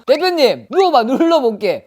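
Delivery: high, loud commanding act; mock exorcist. Shaman parodying exorcist to client, mock loud command. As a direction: order the client down, hammed-up.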